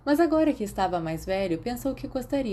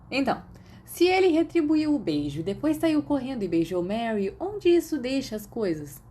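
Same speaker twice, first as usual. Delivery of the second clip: a touch higher, teasing and curious; lightly raised voice of a cheeky imaginary friend; lightly voiced teasing friend; 6 semitones higher than usual. A narrator's reading. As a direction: prod him playfully with questions.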